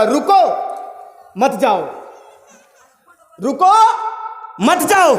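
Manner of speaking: रुको rising, a long pause, then मत जाओ as one unit; pause after रुको. Shown as a demonstration. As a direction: stop dead after रुको before saying मत जाओ. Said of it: "रुको के बाद आपको थोड़ा सा रुक जाना पड़ेगा".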